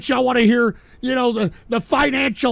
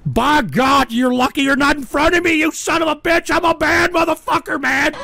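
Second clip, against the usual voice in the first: high, raised-voice tirade; angry threat; 4 semitones higher than usual. Real, not acted. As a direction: build into a furious challenge to the heckler. Host threatens the chat.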